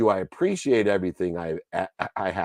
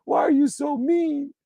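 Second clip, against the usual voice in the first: put-on much higher wailing little child's voice; mock sobbing toddler pleading, much higher; crying toddler. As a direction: whine it like a hurt three-year-old. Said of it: "start crying, going".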